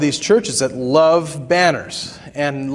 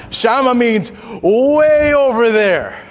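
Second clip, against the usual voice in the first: Shammah said shahm-MAH with the second syllable stretched high and loud; stressed second syllable, drawn out; 8 semitones higher, 4 dB louder than usual. Shown as a demonstration. Preacher drawing out the far-off meaning for the congregation, high and slow. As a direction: stretch out "way over there" slowly, high and loud. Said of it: "If you emphasize the other syllable".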